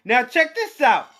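high rapid repeated look; excited. Real, not acted. Calling for attention again.

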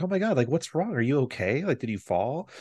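high, alarmed mock worry; worried wife. The wife hears her son scream and asks what's wrong, with mock alarm. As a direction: imitate the wife rushing in, a touch panicked. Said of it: "She's like".

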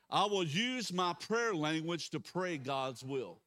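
loud, slow, deliberate confession; declaration of faith. Real, not acted. Pastor leading a prayer declaration, slow and firm. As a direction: lead the room word by word.

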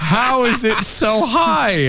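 high, slow, laughing question; laughing disbelief. Real, not acted. Repeating the question about her rating, laughing. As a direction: ask it again through a laugh. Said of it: "I need to know".

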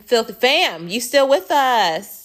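high, loud shout-out with a drawn-out end; calling out. Real, not acted. Host checking in with podcast listeners, calling out. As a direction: holler a check-in to the audience.

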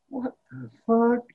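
slow, high incredulous what the fuck; imitating Jay Baruchel. Imitating Jay Baruchel reacting to McConaughey, slow high disbelief. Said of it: "He's just like".

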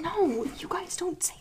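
urgent hushed whisper; breathy warning whisper. Acted. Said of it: "We're whispering".

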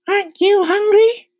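high, loud spider voice; spider asking suddenly. A narrator's reading. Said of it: "she asked suddenly".

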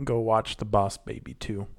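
ordinary delivery

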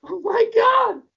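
high, slow exasperated shout. Real, not acted. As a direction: cry out in disbelief. Exasperated at stoned friend.

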